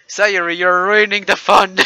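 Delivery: loud annoyed yell; shouting. Acted. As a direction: yell it out, fed up. Annoyed friend yells across room.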